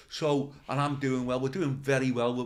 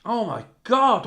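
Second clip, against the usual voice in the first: slow, stunned oh my God; shocked; 5 semitones higher, 7 dB louder, slower than usual. Retold or imitated. Retelling his shock at the boot polish, slow stunned. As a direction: gasp it out slowly in disbelief. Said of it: "So I went".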